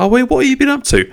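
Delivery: chirpy imitation of a friend asking; mock eager question. Host mimics someone asking what he's been up to, high and eager. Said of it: "people say".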